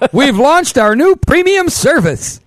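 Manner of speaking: high, loud, slow proclamation; over-the-top excited announcer; hammed-up announcement. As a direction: proclaim it big, stretching each word. Hammed-up announcement after "Good news, everyone.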